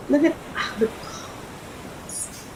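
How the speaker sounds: very soft, slow, voiceless whisper; awed whisper. Real, not acted. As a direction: whisper it in wonder, drawn out. Awed first look at vest colors.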